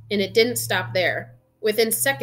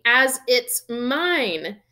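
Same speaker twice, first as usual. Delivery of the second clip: slow, raised indignant insistence; furious; 4 semitones higher, 4 dB louder, much slower than usual. A narrator's reading. Boy claims his own letter, furiously. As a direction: stretch it out in angry protest. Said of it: "said Harry furiously".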